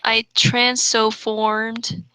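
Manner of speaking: usual speaking voice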